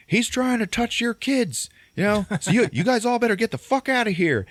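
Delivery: high, alarmed mock shout; acted public outcry; shouting to crowd. Shouting to scared onlookers.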